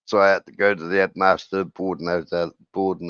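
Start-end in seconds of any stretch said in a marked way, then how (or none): none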